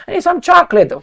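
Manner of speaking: high put-on voice demanding a treat; coy falsetto. The guest stalling the host again, high coy falsetto. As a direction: whine it in a falsetto. Coy partner in mock seduction.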